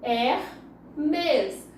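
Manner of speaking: "Hermes" split into her... mes; "Hermes" with a pause between its two syllables. Shown as a demonstration. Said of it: "dois sons".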